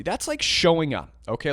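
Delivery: normal talking voice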